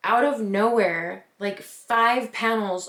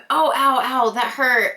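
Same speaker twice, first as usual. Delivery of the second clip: louder, higher mock yelps of pain; acted pain; 4 semitones higher, 6 dB louder than usual. Storyteller acting out hitting the wall, mock pained cries. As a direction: re-enact getting hurt, ows punched out.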